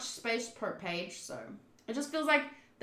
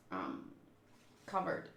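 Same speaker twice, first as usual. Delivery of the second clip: ordinary delivery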